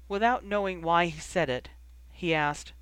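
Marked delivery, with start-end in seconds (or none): none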